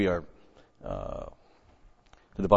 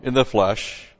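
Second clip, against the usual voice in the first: loud, full-force preaching read; preaching scripture; 2 semitones higher, 8 dB louder than usual. Acted. Preacher reading scripture to his congregation, full force. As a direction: proclaim the verse to the congregation.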